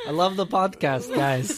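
high, laughing put-on New Zealand accent; hammed-up New Zealand accent through laughter; joke Kiwi accent. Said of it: "that accent out".

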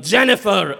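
loud call of Jennifer. Retold or imitated. Attendant calling a woman across the steam room, loud call. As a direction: summon her loudly.

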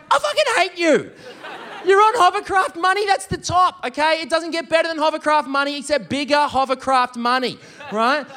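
high-pitched exasperated rant; worked-up, high comic indignation; mock outraged envy. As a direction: burst out in comic resentment. Envious outburst at rich friend.

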